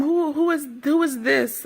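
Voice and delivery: high put-on Creole-accented voice. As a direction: mimic a mother questioning in a high Creole accent. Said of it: "Creole accent".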